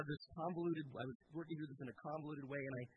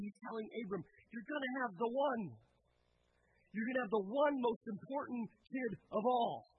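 louder, higher preaching climax; full-force preaching; 6 semitones higher, 6 dB louder than usual. Acted. Pastor proclaiming Christ the one seed to the congregation, full force. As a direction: build to a ringing proclamation.

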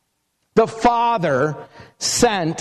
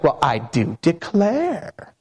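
slow, drawn-out Southern belle accent, imitating the lady; a playful Southern drawl on an old Alabama phrase; mock Southern belle; 5 semitones lower, slower than usual. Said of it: "with those southern eyes".

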